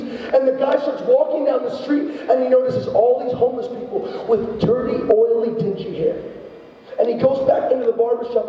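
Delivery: normal talking voice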